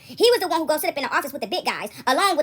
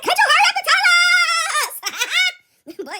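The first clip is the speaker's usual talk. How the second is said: put-on much higher, louder squeaky kid voice; cartoon little boy; 14 semitones higher, 6 dB louder, faster than usual. Teller imitating a cartoon boy rushing her, high kid voice. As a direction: whine it like an impatient cartoon kid. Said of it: "I'm like that little boy on that cartoon".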